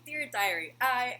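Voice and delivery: loud, punchy imitated diary opening; voiceover diary quote. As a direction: announce it like a dramatic diary voiceover. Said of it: "doing the voiceover".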